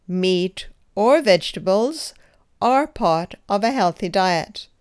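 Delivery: "are" said with a clear push of stress. Shown as a demonstration. Teacher gives the correct answer, stressing "are". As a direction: lean on "are", the verb. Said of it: "Emphasize the verb as I did".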